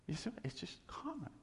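whispered aside, breathy and hushed; secret-sharing whisper. Real, not acted. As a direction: drop to a whisper for the point. Preacher drops to whisper.

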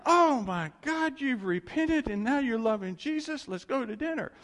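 high, loud mock gush; overjoyed fake welcome, hammed up; gushing convert. Questioners never said this, high mock gushing welcome. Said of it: "They didn't like".

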